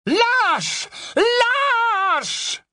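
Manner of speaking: high, loud furious cry; shouting. Acted. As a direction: yell it after the fleeing coward. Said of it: "McGonagall s'écrier".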